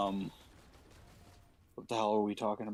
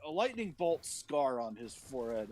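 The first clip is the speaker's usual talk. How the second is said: usual speaking voice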